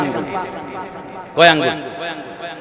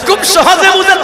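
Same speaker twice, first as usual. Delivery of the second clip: much higher emphatic repeat; preaching at full force; 13 semitones higher than usual. Acted. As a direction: lift the repeat to a cry.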